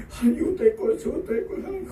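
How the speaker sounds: high, begging the list of deeds; mock desperate plea. 히스기야 왕이 체면 버리고 높은 목소리로 매달리는. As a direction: pile up your deeds and beg. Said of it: "다 끄집어내서".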